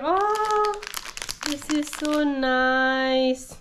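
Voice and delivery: normal talking voice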